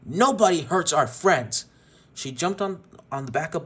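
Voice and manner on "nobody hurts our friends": slow, louder, higher defiance; vicious growl. Acted. Jessie defying Tex before charging, slow angry shout. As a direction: spit it at him through the threat. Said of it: "Jessie growled viciously".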